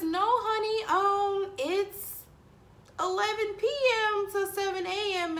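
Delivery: high, bright quoted voice of the boss; quoting the boss. Retold or imitated. Telling the listener what the boss offered, in a lifted quoting voice. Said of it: "she says".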